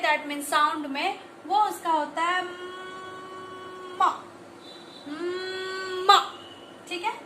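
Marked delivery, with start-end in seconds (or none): 2.4-6.2 "म" with a long hummed mmm, released into a short ma; phonics sound demo. Shown as a demonstration. Teacher shows M's sound in phonics, long hummed "म". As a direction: hold the m of "म", then let it go. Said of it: "और इसका pronunciation जो करते हैं फोनिक्स में".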